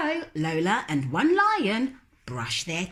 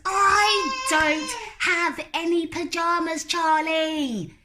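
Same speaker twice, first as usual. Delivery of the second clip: put-on high, stubborn little-girl voice, louder; little girl refusing; 11 semitones higher, 4 dB louder than usual. Lola refusing to go to bed, high and stubborn. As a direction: dig in like a stubborn small child.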